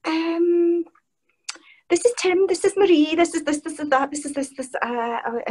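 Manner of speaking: high, stammering gabble trailing into ums; mock starstruck panic, tripping over repeats; flustered introductions. Fan introducing colleagues to Kiefer, flustered and high.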